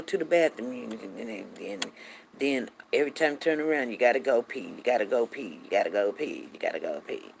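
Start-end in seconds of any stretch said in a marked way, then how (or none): 3.9-7.3 weary repetition. Retold or imitated. Repeats endless bathroom trips, fading.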